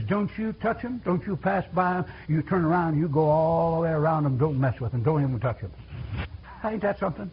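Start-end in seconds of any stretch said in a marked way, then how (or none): 0.0-5.7 softer, lower firm instruction; gentle command. Retold or imitated. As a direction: quietly warn them off. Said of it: "the Lord says".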